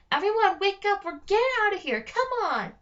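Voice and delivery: loud, high urgent shout; calling out. Acted. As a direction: rouse everyone from their sleep. Alex calling out to sleeping slaves.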